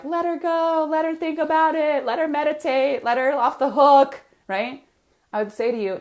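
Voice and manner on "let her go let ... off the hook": high, louder wheedling inner voice; sing-song the voice that lets clients off the hook; little inner voice. Retold or imitated. Coach acting her inner voice for a client, high and pushy. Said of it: "the voice was like".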